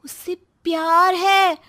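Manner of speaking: put-on a young daughter spreading gossip to mother; sly daughter whispering a secret to mom; Srishti's scheming voice.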